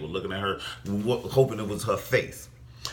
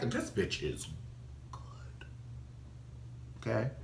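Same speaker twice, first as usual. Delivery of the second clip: whispered aside; 12 dB quieter than usual. Real, not acted. Vlogger whispering her praise of Candace to viewers. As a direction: drop to a breathy whisper on "good".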